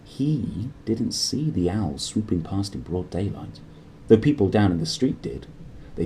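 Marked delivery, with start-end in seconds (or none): none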